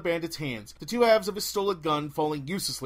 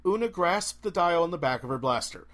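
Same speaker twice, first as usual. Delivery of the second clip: plain reading voice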